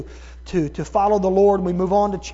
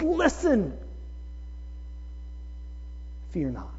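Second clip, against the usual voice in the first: God speaking calm into someone's chaos; gentle God voice; 10 semitones higher, 15 dB quieter than usual. Acted. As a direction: hush the words like calming a child. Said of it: "and he says".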